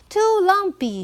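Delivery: put-on high, fussy girl voice; girl complaining. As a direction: reject it like a picky child. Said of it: "she said".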